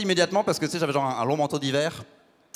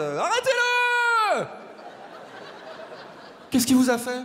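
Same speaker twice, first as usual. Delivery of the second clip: high, stretched-out cries of alarm; drawn-out desperate shouting; frantic shout; much slower than usual. Acted. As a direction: yell it out like you're watching a disaster unfold. Panicked crowd shouting in a scene.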